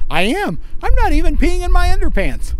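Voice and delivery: put-on high childlike voice; little girl voice. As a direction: play a proud little girl. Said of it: "no lie, she goes".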